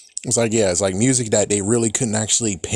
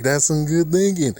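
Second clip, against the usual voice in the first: excited repeat; 6 semitones higher than usual. Shown as a demonstration. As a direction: say it like a proud discovery. Repeats the phrase, third time louder.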